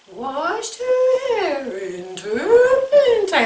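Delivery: high, slow, sliding ghost voice. Shown as a demonstration. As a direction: slide it all around like a ghost. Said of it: "the little sliding around ghost voice".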